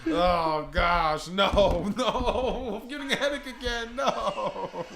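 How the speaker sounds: loud, high mock wail breaking into laughter; over-the-top comic groaning; hammed-up wailing. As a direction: belt it out in exaggerated dismay, cracking up. Hammed-up [name] bit dissolving into laughter.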